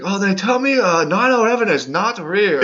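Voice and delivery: high imitated broken English; hammed-up accent, unsure. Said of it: "he's like".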